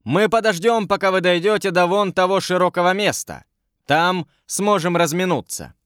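soldier's mild narrated shout; calling out. A narrator's reading. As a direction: call out to travellers ahead without really shouting. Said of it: "Идущий впереди солдат крикнул".

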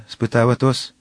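ordinary delivery

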